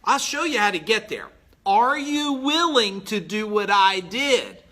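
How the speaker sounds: ordinary delivery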